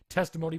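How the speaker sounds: Testimony punched high and loud; stressed Testimony; emphatic stress. Acted. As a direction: hit the word Testimony hard. Lawyer emphasizes accusation.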